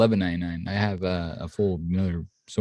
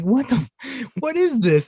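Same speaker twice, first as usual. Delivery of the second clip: baffled disbelief; 3 dB louder than usual. Retold or imitated. Mocking baffled reaction to movie.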